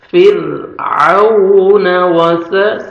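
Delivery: slow chanted recitation, cut off; tajweed recitation. Shown as a demonstration. Teacher chanting the verse to demonstrate joining. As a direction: recite slowly, stop mid-word. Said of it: "سا پڑھیں گے".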